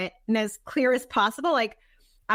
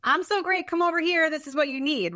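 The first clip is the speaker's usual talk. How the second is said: higher, hammed-up salesy pitch; mock self-promotion; 5 semitones higher than usual. Coach mocking social media bragging, hammed-up salesy. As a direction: parody a bragging social post. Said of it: "being like".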